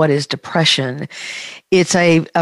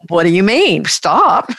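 mock disbelief, jumping higher on the last word; incredulous; 4 semitones higher, 4 dB louder than usual. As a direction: imitate a patient baffled by the very idea. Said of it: "look at me incredulously".

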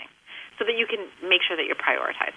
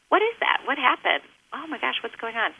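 put-on much higher, a child asking what happened; child's startled questions; 5 semitones higher than usual. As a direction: voice the kid's curious, worried questions. Acted child's questions, high.

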